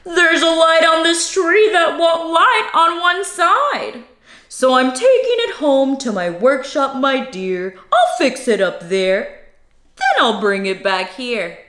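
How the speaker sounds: a hint of a sly, coaxing Grinch; lightly voiced fake Santa. Grinch fibbing to the little girl, lightly voiced. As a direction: coax the child gently, barely in character. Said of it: "the fake Santa Claus lied".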